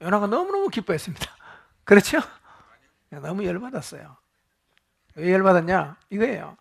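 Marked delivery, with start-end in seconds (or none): none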